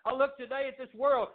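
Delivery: ordinary talk, nothing marked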